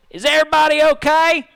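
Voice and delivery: loud high call to the group. Acted. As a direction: shout it to the others in the dark. Calling out after the fall.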